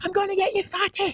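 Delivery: very high, hushed creepy taunt; hushed squeaky menace; soft high taunt. Acted.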